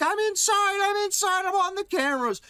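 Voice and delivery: very high frantic shriek; screaming man. Retold or imitated. Naked man yelling at police, shrill panic. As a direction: shriek it as if safety has come. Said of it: "And he's like".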